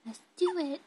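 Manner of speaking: high, lifted encouragement. Real, not acted. Coaxing baby to get dressed.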